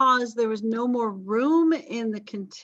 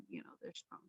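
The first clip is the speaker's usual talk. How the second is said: sudden unvoiced whisper, much softer; whispered aside; 25 dB quieter, faster than usual. Real, not acted. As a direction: drop to a whisper for the joke. Sexist aside, voice drops.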